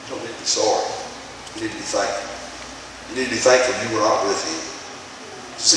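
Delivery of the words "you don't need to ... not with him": soft, low words of a grieving mother; quiet consoling. Retold or imitated. Mother telling her son's crying friend to be thankful, quiet and low. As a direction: say it gently, hushed and lower.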